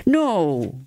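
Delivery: ordinary delivery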